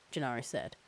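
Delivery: plain reading voice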